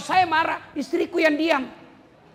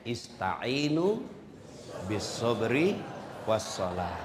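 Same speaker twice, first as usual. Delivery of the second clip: slow, drawn-out Arabic recitation, lower; Quranic recitation; 8 dB quieter, much slower than usual. Acted. Preacher quoting the Quran to the congregation, slow recitation. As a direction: recite it slowly like scripture.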